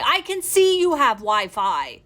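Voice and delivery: loud, drawn-out indignant outburst; exasperated, hammering out each word; exasperated retort. Retold or imitated. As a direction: retell it as a loud, slow protest. Arguing with staff refusing Wi-Fi.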